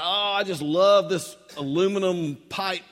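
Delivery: gushing beer lover. Retold or imitated. Preacher imitates boyfriend on the grass, gushing about his beverage. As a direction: gush over every little detail. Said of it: "he's going on about".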